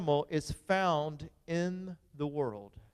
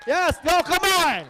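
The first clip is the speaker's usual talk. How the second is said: much higher, louder hype; excited come on; 10 semitones higher, 5 dB louder, slower than usual. Acted. Pastor hyping the audience, excited shout. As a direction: whip the crowd up. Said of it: "When I say come on, that means more".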